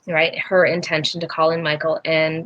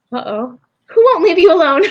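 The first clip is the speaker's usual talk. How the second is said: alarmed uh-oh, raised and louder; startled mother asking, a bit louder; worried surprise; 10 semitones higher, 7 dB louder than usual. Retold or imitated. Mother hearing her daughter's ghost claim, raised alarmed question.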